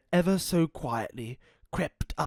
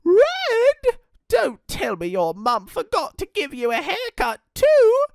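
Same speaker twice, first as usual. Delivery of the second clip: very high, loud shocked granny; startled old gran; gasping in shock; 8 dB louder than usual. Acted. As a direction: Gran seeing the dingo, shocked. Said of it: "Gran gasped".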